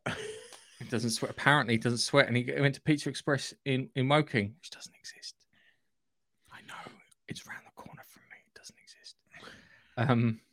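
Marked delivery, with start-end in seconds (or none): 4.6-9.2 whispered conspiracy joke; hushed, voiceless aside. Real, not acted. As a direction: lean in and whisper it like a secret. Conspiratorial joke about Pizza Express.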